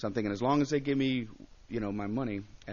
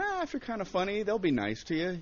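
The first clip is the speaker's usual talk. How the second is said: naive optimism; 8 semitones higher than usual. Retold or imitated. Comic recalls reassuring himself before a rough gig, naively hopeful. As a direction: play your younger, naive self. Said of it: "you know, I'm naive".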